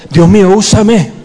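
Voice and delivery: fervent congregational cry. Shown as a demonstration. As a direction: raise the voice in prayer. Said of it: "ora conmigo".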